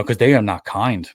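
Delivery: ordinary delivery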